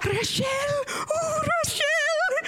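very high, drawn-out mock squeal; high-pitched, stretched-out imitation of astonished staff; imitated staff reaction. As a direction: squeal the name in hammed-up reaction. Staff react to the booming, retold.

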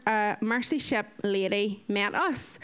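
usual speaking voice

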